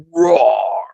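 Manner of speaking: low playful mock roar; hammed-up kids' show roar; dinosaur roar. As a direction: growl it like a toy dinosaur. Toy dinosaur roars in scene.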